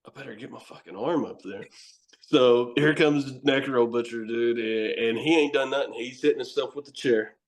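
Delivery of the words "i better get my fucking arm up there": low, soft self-talk; subdued realization. Retold or imitated. Wrestler froze at the warning, retold as soft low self-talk. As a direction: say it softly to yourself, pitch dropped.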